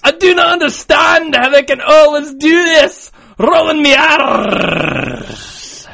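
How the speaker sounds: loud, high Scottish burr with drawn-out Rs; Scottish accent. Pretend. Podcaster finishing the listener's letter, shouted Scottish accent. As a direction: belt it out in broad Scots. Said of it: "read it in a Scottish accent".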